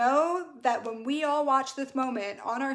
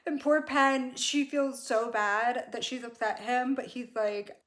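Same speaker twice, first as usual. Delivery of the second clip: ordinary talk, nothing marked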